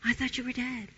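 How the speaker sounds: lightly voiced lion. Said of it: "he says".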